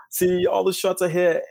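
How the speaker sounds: high, slow whiny complaint. Retold or imitated. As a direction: drag out the whine. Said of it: "that whiny voice that you just did".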